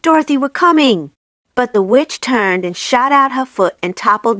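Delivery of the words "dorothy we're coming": higher shouted call to Dorothy; mock shriek from behind the door; lion's raised call. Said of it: "he shrieked".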